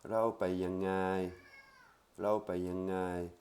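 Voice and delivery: "Rao pai yangai" slow and deliberate; slow Thai demo. Shown as a demonstration. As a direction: repeat "Rao pai yangai" slowly for learners. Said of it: "On y va comment?".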